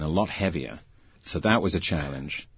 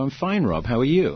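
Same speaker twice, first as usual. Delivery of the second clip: higher, brightly casual reply; feigned nonchalance; 6 semitones higher than usual. Retold or imitated. Injured actor hiding pain from director, breezily casual. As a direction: brush it off as no big deal. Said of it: "trying to brush it off like it was no big deal".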